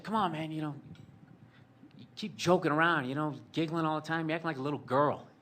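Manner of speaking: mock a hectoring father; scolding dad. Mimics his father scolding him.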